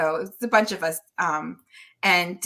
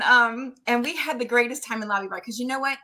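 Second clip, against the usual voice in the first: ordinary talk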